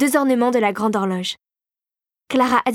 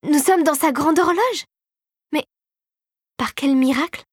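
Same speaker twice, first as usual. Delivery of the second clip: amazed exclamation; 5 semitones higher than usual. A narrator's reading. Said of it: "s'écria-t-elle".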